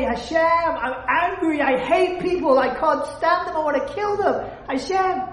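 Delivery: mock plea, building up; imitated pleading confession. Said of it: "so that you say".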